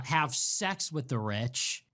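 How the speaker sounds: usual speaking voice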